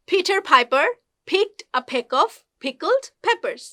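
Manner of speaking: slow "Peter Piper" with puffed Ps; careful, spaced-out model of "picked a peck"; P sound drill. Shown as a demonstration. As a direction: pop each P in "pickled peppers", word by word. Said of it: "পি সাউন্ডটা উচ্চারণ".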